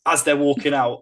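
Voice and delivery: ordinary talk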